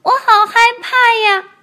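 高高的童声，带着害怕; 像吓坏的小孩那样喊; 害怕的宝宝. Acted. 甲龙宝宝抱住霸王龙. (高声的害怕童声)

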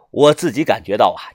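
plain reading voice

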